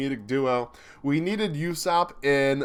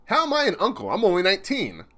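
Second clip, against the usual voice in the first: lightly voiced protest; 5 semitones higher than usual. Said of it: "voicing character protest".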